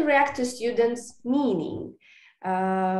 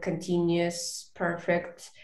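ordinary delivery

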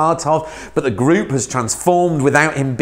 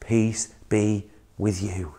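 softer, very slow peace-giving voice; Jesus greets his frightened disciples, softly; gentle blessing; 4 semitones lower, 8 dB quieter, slower than usual. Acted. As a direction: breathe the greeting out tenderly.

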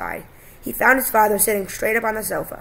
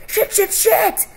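much higher, loud, drawn-out swearing; panicked cry; 13 semitones higher, 5 dB louder, much slower than usual. A narrator's reading. Son sees Dad sliced by knives, panicked cry. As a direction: cry it out slowly and high in panic. Boy panics as father is cut.

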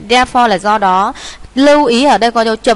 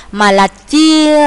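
"chia" held long and louder; drawn-out correction; 6 semitones higher, 4 dB louder, much slower than usual. Real, not acted. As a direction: stretch out "chia", pitched up and held. Teacher holds a word for correction. (kéo dài, lên cao chữ "chia")

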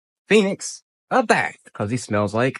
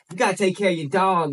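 normal talking voice

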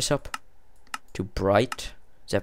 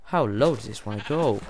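normal talking voice

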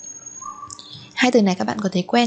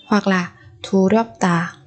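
usual speaking voice

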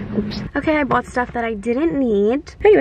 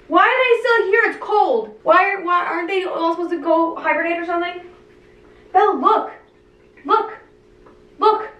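anxious squealing; 7 semitones higher than usual. Real, not acted. Still panicking over crickets.

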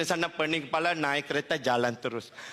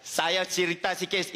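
normal talking voice

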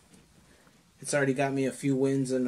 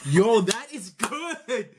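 laughing; 7 semitones higher, slower than usual. Real, not acted. Laughing at blind drawing.

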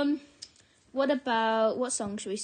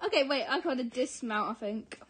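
normal talking voice

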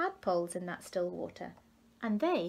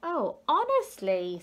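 slow, exasperated, louder and higher; frustrated; 7 semitones higher, 5 dB louder, slower than usual. A narrator's reading. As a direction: let out the frustration.

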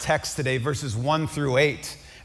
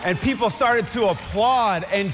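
preaching at full force; 6 semitones higher than usual. Acted. Pastor stirring the congregation at a funeral, near shouting. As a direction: preach it out, lifting the room. Preacher builds to eulogy climax.

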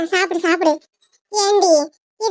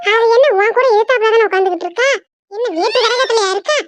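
usual speaking voice

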